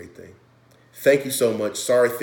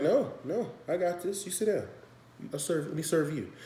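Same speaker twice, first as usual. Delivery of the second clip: high, soft imitation of Jesus calming them; gentle Jesus; 8 semitones higher, 6 dB quieter than usual. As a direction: lightly play Jesus, softer and higher. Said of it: "And Jesus was like".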